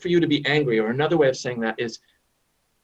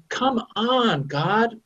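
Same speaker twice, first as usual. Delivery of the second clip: exasperated plea; 8 semitones higher, much slower than usual. Retold or imitated.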